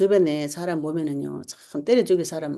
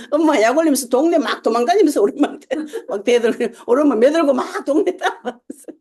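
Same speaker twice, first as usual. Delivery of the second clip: loud, high telling through laughter; real giggly, excited storytelling; laughing; 8 semitones higher, 7 dB louder than usual. Real, not acted. Laughs telling own childhood mischief.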